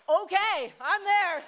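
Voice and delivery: loud, high, thrilled acceptance; eager yes. Retold or imitated. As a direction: blurt it out like you can't believe your luck.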